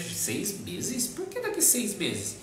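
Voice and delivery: high-pitched retold incredulous question; amused disbelief. Friends reacting to her saving a cereal bar, retold amused disbelief. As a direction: imitate friends puzzled by the odd request, pitched well up. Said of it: "a gente começou a rir".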